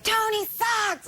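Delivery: high, slow imitated heckle; mock fan jeer. Retelling hostile fans, high mock heckling. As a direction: jeer like a rude arena fan. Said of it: "they'll be like".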